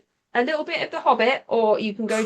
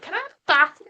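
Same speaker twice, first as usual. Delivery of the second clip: higher, Northern bath with a short a; a hint of a Scouse little girl; daughter's Northern accent; 7 semitones higher, faster than usual. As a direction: imitate a child's Northern bath. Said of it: "she's all like".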